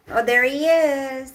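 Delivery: loud, bright hello to the dog; excited greeting. Real, not acted.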